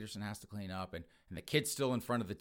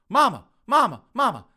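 high, very loud yell; urgent calling; 11 semitones higher, 15 dB louder than usual. Retold or imitated. Son running into the kitchen for mother, yelling. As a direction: holler for Mom as you burst in.

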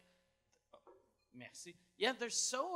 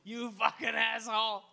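loud insult through laughter; laughing mock insult; 4 semitones higher, 8 dB louder than usual. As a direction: yell it while cracking up. Joins in acted yelling, laughing.

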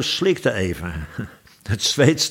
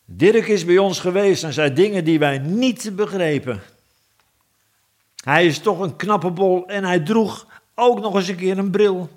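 high, eager dwarf voices; calling out excitedly; 7 semitones higher than usual. Acted. Dwarves rush to the king, eager and high. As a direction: blurt it out to the king. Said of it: "riepen ze".

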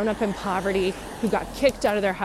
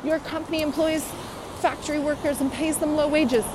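mock outrage; 7 semitones higher than usual.

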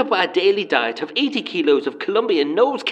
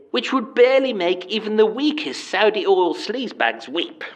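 normal talking voice

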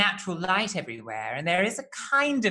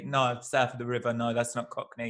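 lower, lightly done Cockney protest; a territorial Londoner insisting in Cockney; Cockney accent; 8 semitones lower, 3 dB quieter than usual. Retold or imitated. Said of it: "Cockneys get very territorial and they say".

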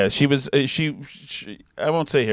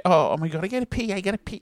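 higher, softer mock Boston accent; imitated Boston girlfriend; 5 semitones higher, 4 dB quieter, faster than usual. Girlfriend announcing she has to pee, imitated Boston accent. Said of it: "I heard she used to say".

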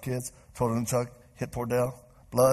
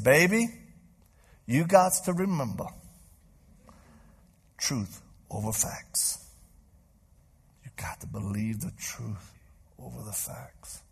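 Cajun mama; slower than usual. Retold or imitated. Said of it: "how my little Cajun mama says".

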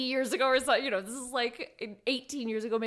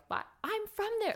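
mock indignant surprise; 12 semitones higher, faster than usual. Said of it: "and I'm like".

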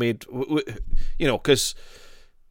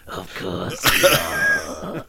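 drawn-out mock line; 3 semitones higher, much slower than usual. Echoing the quote, slower.

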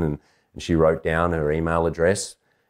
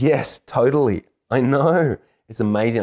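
gushing agreement; 7 semitones higher, 4 dB louder than usual. Retold or imitated. Girl agreeing with a stranger about lip balm, gushing. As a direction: agree with her whole heart, pitched up.

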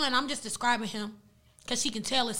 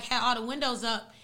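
ordinary talk, nothing marked

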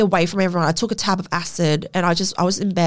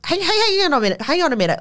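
startled protest; 8 semitones higher, faster than usual. Retold or imitated. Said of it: "I was like".

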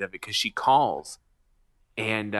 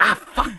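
mock pained curse; 10 semitones higher, 4 dB louder than usual. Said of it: "I was like".

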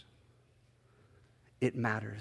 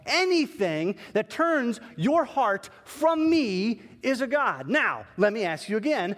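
higher and louder sermon voice; preaching at full force; 8 semitones higher, 3 dB louder than usual. Acted. God lecturing the pastor about idols, preaching hard. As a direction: drive the point home like a preacher.

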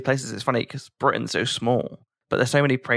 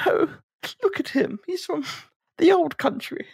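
much higher, cooing toff voice; mock posh British lady. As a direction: marvel at him like a museum piece. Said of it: "they were like".

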